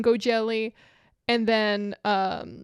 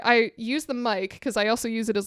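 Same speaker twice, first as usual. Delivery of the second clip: ordinary delivery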